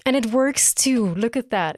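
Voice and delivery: high, excited squeal of delight; excited outburst. Real, not acted. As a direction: burst out thrilled by the scene. Fangirling over the two characters.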